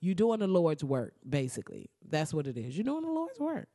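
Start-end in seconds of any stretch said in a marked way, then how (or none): 2.8-3.7 much higher, softer churchy praise; mock church praise. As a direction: sing out the praise like a church lady. Repeated as mock preacher.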